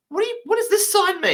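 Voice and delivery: very high confused asking; high puzzled question. Retold or imitated. German asking the Egyptian what the sign means, high squeak. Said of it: "he goes".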